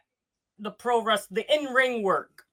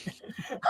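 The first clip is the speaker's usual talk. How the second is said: breathy laugh; 12 dB quieter than usual. Real, not acted. Laughs at own joke.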